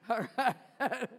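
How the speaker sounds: real chuckle breaking into the words; amused laughing. Real, not acted. Preacher regrouping after a joke to the congregation, laughing. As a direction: laugh through it while moving on.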